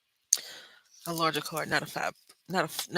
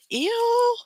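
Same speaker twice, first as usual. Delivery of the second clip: disgusted ew; 4 dB louder than usual. Retold or imitated. Disgusted reaction.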